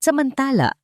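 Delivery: ordinary talk, nothing marked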